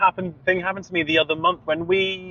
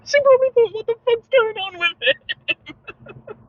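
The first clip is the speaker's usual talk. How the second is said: very high, wheezy laughing talk; 3 dB louder, slower than usual. Real, not acted. Imagining the waitress's reaction, cracking up. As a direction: struggle through helpless laughter.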